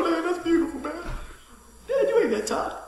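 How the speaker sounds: a high, laughing tease from his son; lightly voiced teasing son. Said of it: "my sons will say something".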